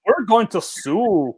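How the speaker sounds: put-on slow Minnesota-Norwegian accent. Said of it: "Minnesota accent".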